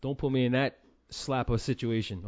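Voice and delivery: ordinary talk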